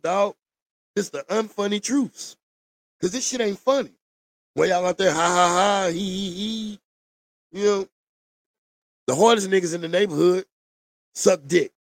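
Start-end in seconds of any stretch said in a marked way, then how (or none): 5.1-6.8 mock laughing. Mocking people laughing at him.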